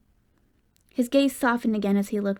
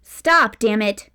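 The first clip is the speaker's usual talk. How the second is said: louder rising shout of stop; mother shouting after her son; angry shouts; 7 dB louder, much slower than usual. A narrator's reading. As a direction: yell at him to halt. Calling after him angrily.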